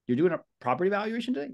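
surprised friend. Retold or imitated. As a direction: play the friend amazed you're still in real estate. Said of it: "And they'll say".

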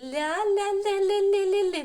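ля chanted high and loud with a soft л. Shown as a demonstration. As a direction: trill a quick ringing «ля-ля-ля», smiling. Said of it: "Не твердий, м'який". (дзвінке м'яке «ля-ля-ля»)

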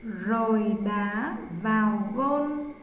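usual speaking voice

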